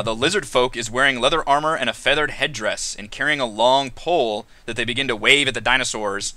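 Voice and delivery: normal talking voice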